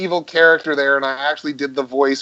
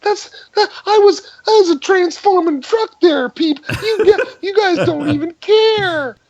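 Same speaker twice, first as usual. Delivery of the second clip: squeaky, much higher offended complaint with a laugh; high mock indignation. Actor mocking his own hurt pride, high and laughing. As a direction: whine up high, half laughing. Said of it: "mock indignant, pitched up".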